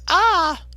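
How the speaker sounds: high, loud held "aah"; imitated yell of someone falling; cartoonish falling scream; high mock cry. Imitates Taylor falling into chair.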